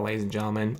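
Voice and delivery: ordinary talk, nothing marked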